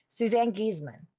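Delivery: ordinary talk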